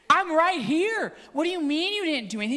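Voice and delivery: mock-outraged uncle; retold exasperation. Exasperated uncle arguing with nephew.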